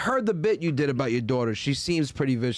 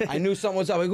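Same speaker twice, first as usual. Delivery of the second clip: ordinary delivery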